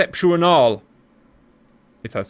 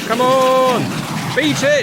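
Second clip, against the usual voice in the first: high-pitched urging, drawn out; will the pilot on with a raised voice; urgent cheering; 8 semitones higher, slower than usual. Real, not acted. Commentator willing a jet to outrun a missile, urgent cheering.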